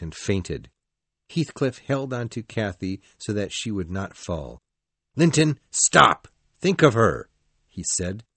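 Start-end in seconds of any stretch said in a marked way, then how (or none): 5.1-7.3 loud, commanding appeal; urgent shout. Acted. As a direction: halt an attacker with a raised voice. Heathcliff halts the angry husband.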